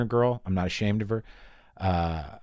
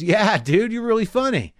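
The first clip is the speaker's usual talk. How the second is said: a raised, chummy "Yeah, dude"; friendly biker; 10 semitones higher, 4 dB louder than usual. Retold or imitated. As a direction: play the tough guy suddenly gushing. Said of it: "And the guy goes".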